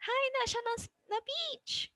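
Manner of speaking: exaggerated high pitch level for demonstration. Shown as a demonstration. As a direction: jump far up into a very high pitch. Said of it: "that is high".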